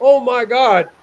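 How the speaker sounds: hammed-up dismay; shocked. Bob learning his cat died, mock shocked.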